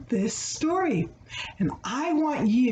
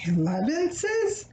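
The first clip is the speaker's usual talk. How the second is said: Elevenses asked up high as a mock question; playful question; 6 semitones higher than usual. Shown as a demonstration. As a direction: echo Elevenses like a puzzled child.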